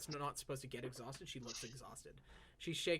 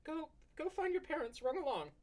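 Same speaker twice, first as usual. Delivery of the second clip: tired tender send-off; 12 semitones higher than usual. Acted. Elf sends the child off.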